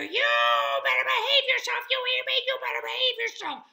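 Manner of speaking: tiny creature's very high, fast shriek; squeaky screaming. A narrator's reading. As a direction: scold at full squeak, very loud and rapid. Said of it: "the more Alfred screamed in his ear".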